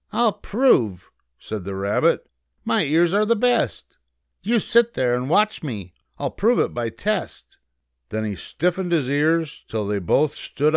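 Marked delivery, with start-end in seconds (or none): 0.1-1.1 high, loud rabbit retort; cocky high rabbit voice; confident rabbit. A narrator's reading. Rabbit answers the challenge. 2.6-7.6 high-pitched, confident rabbit voice; cocky little rabbit; boastful rabbit. A narrator's reading. Rabbit answers the challenge.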